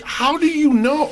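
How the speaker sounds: high, slow surprised question; amazed that he's heard. Retold or imitated. Storyteller asking the musician how he knows, surprised. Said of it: "I'm like".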